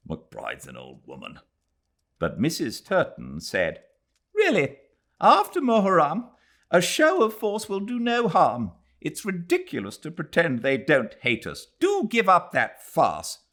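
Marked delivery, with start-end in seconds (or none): 0.1-1.4 low, soft, irritated grumble under the breath; irritated mutter. Acted. Collector grumbling about McBride in the car, irritated mutter. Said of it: "irritated and muttered". 4.3-13.5 high, imperious memsahib calling upstairs; a bossy older English lady, much higher pitched; Mrs Turton's voice. Acted. Mrs Turton hurrying the girl along to court, high and bossy.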